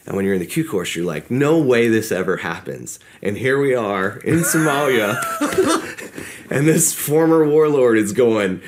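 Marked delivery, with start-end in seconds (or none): 1.3-5.2 loud, high, drawn-out disbelief; amused incredulity. Retold or imitated. Incredulous punchline about warlord absurdity. 5.2-6.5 high-pitched burst of laughing; laughter. Real, not acted. Laughs at own absurd situation. 6.5-8.6 loud, high, slow incredulous telling; amused outburst. Real, not acted. Barely containing laughter at punchline.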